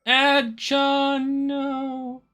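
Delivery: high loud mock wail; melodramatic wail. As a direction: cry out melodramatically. Melodramatic cry.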